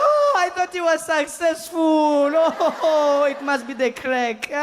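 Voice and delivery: high, very slow wailing granny; devastated grandmother. Retold or imitated. Grandmother seeing him in the woods, mock-devastated wail. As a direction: wail each word out in mock grief. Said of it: "My grandmother saw me out in the woods".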